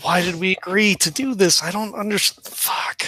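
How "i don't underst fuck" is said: softer, unvoiced muttered curse; whispered exasperation. Real, not acted. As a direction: swear under your breath. Exasperated under breath.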